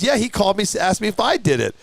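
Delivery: high-pitched imitation of the reply; indignant friend. Suspected truck dropper answering, mildly indignant imitation. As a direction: raise the pitch to mimic the accused friend.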